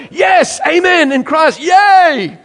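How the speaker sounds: high, loud hammed-up cheering response; excited amen. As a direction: whoop it like a thrilled congregation. Congregation responding to preaching.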